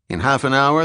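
plain reading voice

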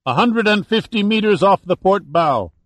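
loud, high lookout's reply; called-out report. A narrator's reading. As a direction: call the position out across the ship. Lookout calls back the position.

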